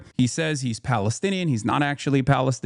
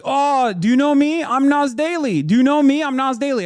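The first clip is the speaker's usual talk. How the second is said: high, louder imitation of a pushy stranger; mock yelling; 10 semitones higher, 4 dB louder than usual. Stranger accosting him at the airport, mock yelling. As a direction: mimic him yelling for recognition, pitched way up. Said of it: "when he approached me, yelling".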